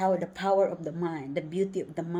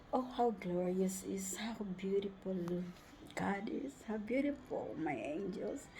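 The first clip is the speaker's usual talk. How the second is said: softer, reverent murmur; hushed praise; 8 dB quieter than usual. Real, not acted. Grateful traveler praising God and her angels, hushed. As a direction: praise it gently, in awe.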